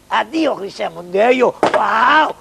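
ordinary talk

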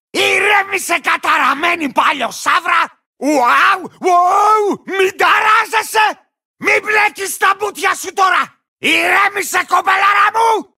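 frantic rider yelling at his panicking mount; cantankerous lizard rider. Acted. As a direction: shout at your unruly beast to calm down.

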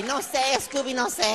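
squeaky dubbed cartoon voice; Shaggy. Cartoon sidekick to his dog, helpless high voice. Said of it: "all of them were Shaggy".

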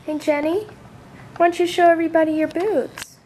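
ordinary delivery

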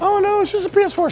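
very high mock falsetto; mocking echo. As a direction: parrot it back in a squeaky taunt. Mockingly echoing the other person.

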